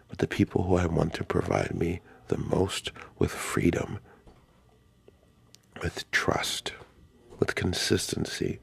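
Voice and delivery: soft unvoiced whisper. Real, not acted. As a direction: breathe it out, barely audible. Whispering his wishes.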